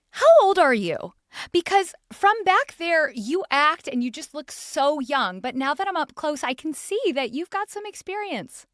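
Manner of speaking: high, loud, mock-tactless fan voice; blunt fan. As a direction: ham up a tactless fan who means it kindly.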